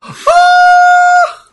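sharp gasp then a very high yelp; shocked gasping scream. Real, not acted. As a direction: gasp and shriek in disbelief. Shocked at ref being choked.

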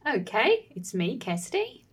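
Kiwi hello with rising tune; New Zealand accent. Shown as a demonstration. Host saying hello as asked, in a New Zealand accent. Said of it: "say hello with New Zealand accents".

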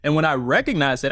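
normal talking voice

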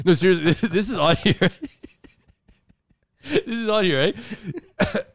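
cracking up mid-sentence; laughing. Real, not acted.

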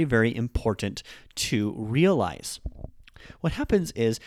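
ordinary talk, nothing marked

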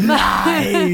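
"Lie" said high through laughter; laughing mock accusation. Host laughing as he reads a listener's accusation, high. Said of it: "Kira is saying".

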